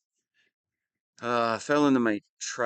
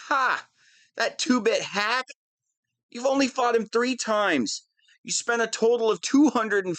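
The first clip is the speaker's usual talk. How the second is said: higher, mocking disdain; scoffing; 7 semitones higher than usual. Villain dismisses the rival she named, higher mocking scoff. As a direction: laugh off the rival as a joke.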